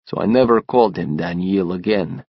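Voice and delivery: usual speaking voice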